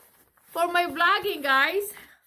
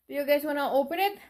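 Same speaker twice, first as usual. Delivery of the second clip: ordinary delivery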